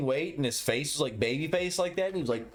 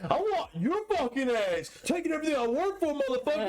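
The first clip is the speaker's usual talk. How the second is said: high-pitched mock fighter's promo; hammed-up, raised-pitch challenge to a rival; Nate Diaz promo; 9 semitones higher than usual. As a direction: imitate a trash-talking fighter's call-out. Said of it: "done the whole Nate Diaz promo".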